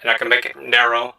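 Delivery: ordinary talk, nothing marked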